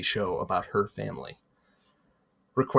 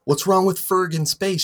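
higher, louder indignant question; excited; 9 semitones higher, 5 dB louder than usual. Acted. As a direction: toss it out, eager and defensive. Clarissa's voiced line in story.

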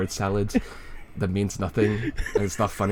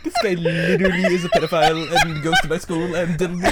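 Leafy impression; 9 semitones higher, 4 dB louder than usual. Mocking Leafy's jokes.

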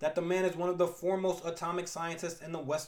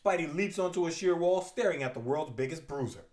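ordinary delivery

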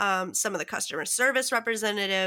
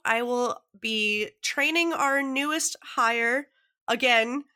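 ordinary talk

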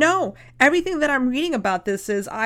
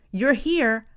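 ordinary delivery

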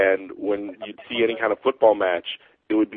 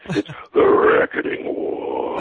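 deep, slow booming announcer voice; James Earl Jones through a megaphone; 8 semitones lower, slower than usual. Shown as a demonstration. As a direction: boom it low like a movie trailer. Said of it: "like you're James Earl Jones talking through a megaphone".

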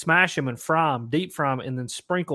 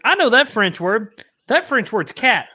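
louder, higher shocked realization; dawning horror at dinner; 6 semitones higher, 7 dB louder than usual. Retold or imitated. Realizing he is eating cat.

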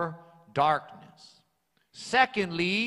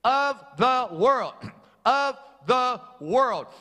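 "of the world" slow and loud, leaning on "of"; preacher hammering "of the world" slowly, weight on "of"; stress on of the world; 4 semitones higher, 4 dB louder than usual. Shown as a demonstration. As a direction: hit "of" hard, then let "the world" fall.

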